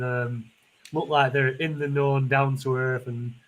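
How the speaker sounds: ordinary talk, nothing marked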